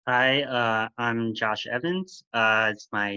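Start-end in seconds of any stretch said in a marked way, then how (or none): none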